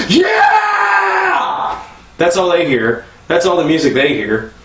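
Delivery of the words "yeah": very high held wild yell; crazy high yell. Retold or imitated. Comic mimics the store music to other shoppers: a wild yell. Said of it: "what it sounds like".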